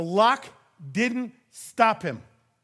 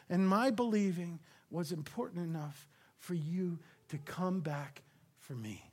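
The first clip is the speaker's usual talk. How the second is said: softer, almost breathed, with long pauses; awed hushed confession; 2 semitones lower, 9 dB quieter than usual. Acted. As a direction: speak it in quiet wonder. Thomas voiced, awed.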